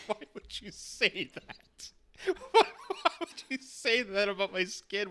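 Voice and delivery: words squeezed out through laughter; laughing disbelief. Real, not acted. Woman asking bot about the skin remark, laughing. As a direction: protest while cracking up.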